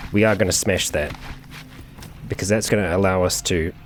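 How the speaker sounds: ordinary talk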